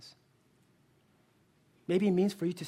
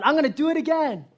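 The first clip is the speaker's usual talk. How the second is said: loud, high triumphant shout; hammed-up daredevil boast, louder and higher; retold yell; 9 semitones higher, 10 dB louder, faster than usual. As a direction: yell it out like a showman on the rope. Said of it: "he yelled".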